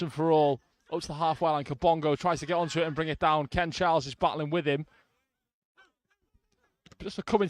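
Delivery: live football commentary; play-by-play commentary. Real, not acted. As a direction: call the match as it happens.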